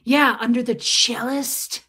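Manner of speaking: a hint of the smug ex-husband, slow; lightly voiced proud ex giving his name; mock pompous ex. Host imitates ex-husband at the host stand, lightly smug. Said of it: "He would be like".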